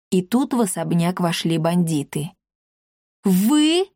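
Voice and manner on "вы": high startled exclamation; astonished. A narrator's reading. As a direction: gasp it out in disbelief. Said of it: "изумлённо воскликнул Рекс".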